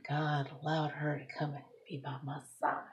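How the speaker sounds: soft tender whisper; hushed, tender whisper. Real, not acted. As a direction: whisper it like a secret prayer. Tender whisper about her mother.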